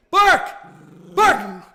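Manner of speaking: sharp high loud bark command. Real, not acted. Streamer loudly urging his dog to bark for the Leafs, sharp high command. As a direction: order the dog to bark, full voice.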